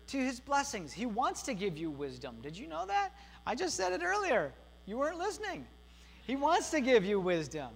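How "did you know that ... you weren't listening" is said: high, mock-scolding tease to the room; playful teasing. Real, not acted. Pastor teasing the congregation for not listening, high playful scold. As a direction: needle them for not listening, pitched way up.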